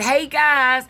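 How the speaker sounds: a loud friendly shout to strangers; calling out. Acted. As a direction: hail the men from the truck. Farmer calling to men by road.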